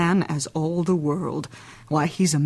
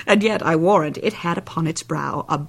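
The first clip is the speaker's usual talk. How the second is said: normal talking voice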